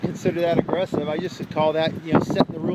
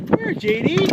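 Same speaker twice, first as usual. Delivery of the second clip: coaxing dog call; 13 semitones higher than usual. Real, not acted. Calling dog to come.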